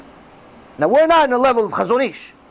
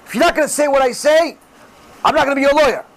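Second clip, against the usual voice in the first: higher-pitched mock yell of a lawyer; stern lawyer; 5 semitones higher, faster than usual. Lawyer warning his client, mock stern yelling. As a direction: lay down an ultimatum to a client. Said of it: "If a lawyer tells his client".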